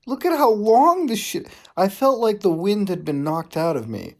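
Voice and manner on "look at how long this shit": exasperated. Real, not acted. Exasperated at the kiss scene.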